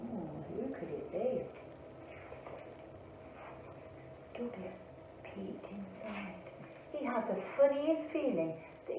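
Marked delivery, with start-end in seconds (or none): 0.1-6.3 much softer, hushed narration; hushed suspense. A narrator's reading. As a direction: build quiet suspense. Suspense in dark cave.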